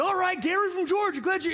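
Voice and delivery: high, peppy host question; mock perky emcee; microphone host. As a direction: imitate an upbeat crowd host. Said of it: "a lady comes out with a microphone".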